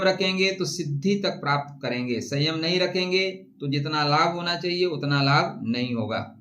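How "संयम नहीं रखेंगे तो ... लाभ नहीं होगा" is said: ordinary talk, nothing marked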